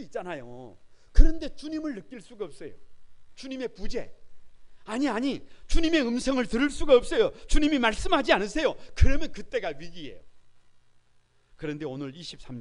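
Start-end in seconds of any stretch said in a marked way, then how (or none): 1.1-10.2 higher and much louder impassioned sermon; preaching at full force. Acted. Pastor urging the congregation to cry out, full force.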